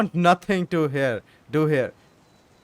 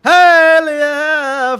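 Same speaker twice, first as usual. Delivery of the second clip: loud, excited cheer; real shout of delight, much louder and higher; hell yeah; 7 semitones higher, 9 dB louder than usual. Real, not acted. Gamer cheering at his screen, loud excited shout. Hyped reaction to game choice.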